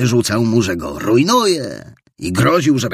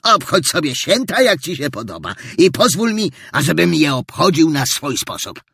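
put-on stern old man; 9 semitones higher, 4 dB louder than usual. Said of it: "przerwał surowo starzec".

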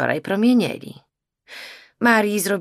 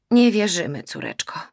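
usual speaking voice

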